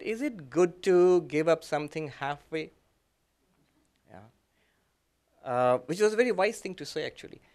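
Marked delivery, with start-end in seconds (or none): none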